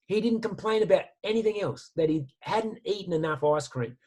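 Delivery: ordinary delivery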